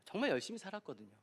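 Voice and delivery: normal talking voice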